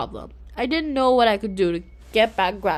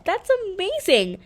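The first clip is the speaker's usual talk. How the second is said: excited; 9 semitones higher than usual. A narrator's reading. Claire thrilled by Cosmo.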